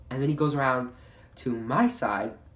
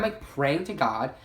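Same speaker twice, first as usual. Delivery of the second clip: ordinary talk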